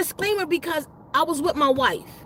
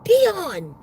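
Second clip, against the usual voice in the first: higher-pitched mock cry of a name; retold scream; 4 semitones higher, 3 dB louder than usual. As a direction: imitate her crying out, without full volume. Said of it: "she just screamed".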